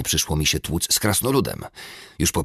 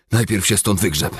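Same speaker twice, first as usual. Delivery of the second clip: wheezing retort; 5 semitones higher, 5 dB louder than usual. A narrator's reading. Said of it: "wyrzęziłem".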